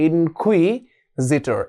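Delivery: ইনকুইজিটর said very slowly, syllable by syllable; slow drilled pronunciation. Shown as a demonstration. Teacher drilling the spelling aloud for students, slow and deliberate. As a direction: draw out ইনকুইজিটর so students can hear each part. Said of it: "এভাবে নিজেকে নিজে বলবে".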